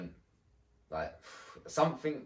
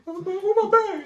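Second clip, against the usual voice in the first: very high, loud mock shriek; hammed-up panicky squeal of a friend; mimicked panic; 12 dB louder than usual. Imitates friend panicking while packing.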